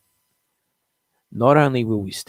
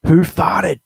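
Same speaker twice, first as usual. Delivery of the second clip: loud, higher showman announce of the title; segment title announcement; 8 semitones higher, 8 dB louder than usual. Acted. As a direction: sell the segment name like a TV host.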